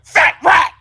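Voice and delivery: high, loud sneering voice; mock villain voice. Reading the letter aloud, hamming up a sneering villain. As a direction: spit it out like a cartoon villain.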